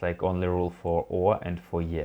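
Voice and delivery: ordinary delivery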